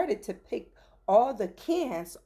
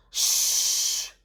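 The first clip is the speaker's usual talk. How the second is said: shushing. Acted. Dad hushing shouting man.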